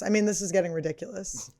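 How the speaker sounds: normal talking voice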